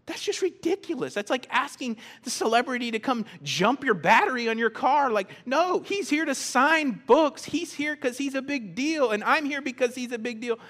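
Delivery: high, worked-up indignation; outraged bystander, pitch pushed way up; incredulous protest. Acted. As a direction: protest loudly at the absurd request. Acted outraged bystander at book signing.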